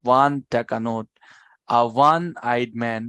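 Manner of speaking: very slow "a one eyed man", word by word; teacher drawing out the example. Shown as a demonstration. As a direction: spell it out slowly for the class. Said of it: "যেমন হচ্ছে অন টাকা".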